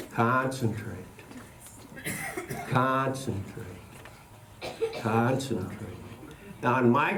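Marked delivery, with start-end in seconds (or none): 0.1-6.0 hushed. Acted. Magician asks audience for concentration.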